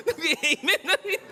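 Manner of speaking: words squeezed out through laughter; laughing while talking. Real, not acted. Laughing at own joke.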